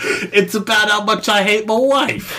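hammed-up celebrity impression; Ray Romano. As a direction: do a badly faked comedian voice. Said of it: "give me your Ray Romano impression".

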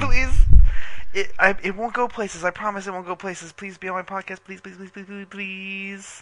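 high, pleading, fading to near whisper; begging. Acted. Begging friend for podcast.

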